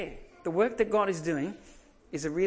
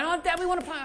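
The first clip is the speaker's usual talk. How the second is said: put-on high, whiny kid's plea; pleading child; 12 semitones higher, 5 dB louder than usual. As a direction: beg like an eager little kid. Kids pleading to play.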